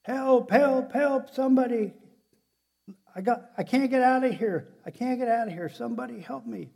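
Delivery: high, loud cries for rescue; yelling for help. Acted. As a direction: holler up out of a pit, trapped. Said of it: "he starts yelling".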